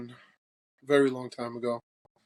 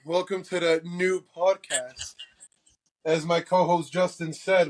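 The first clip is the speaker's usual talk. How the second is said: ordinary talk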